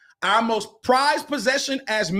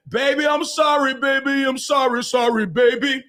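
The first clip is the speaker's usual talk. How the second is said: higher, gushing mock apology; mock pleading; 4 semitones higher than usual. Imitating a man begging his woman's forgiveness, mock pleading. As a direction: plead it over and over, hammed up.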